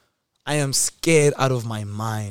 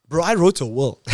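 comic loud reveal; 3 semitones higher, 7 dB louder than usual. Real, not acted. Punchline of the will.

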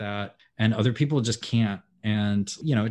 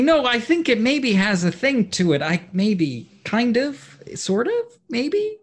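high, slow, wavering mock of Liam hedging; 13 semitones higher, 7 dB louder, slower than usual. Mocking Liam's hedging to the cohost, high and drawn out. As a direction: imitate a friend waffling, pitched way up. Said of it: "Liam keeps trying to convince me".

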